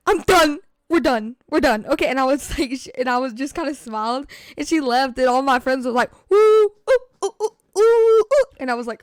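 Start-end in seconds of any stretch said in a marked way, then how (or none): 0.1-1.9 loud mock-sobbing breakup; imitated sobbing. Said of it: "sobbing during lunch". 6.3-8.5 loud, high drawn-out "woo, ooh"; teasing hoots. Retold or imitated. Imitates friends hooting at breakup.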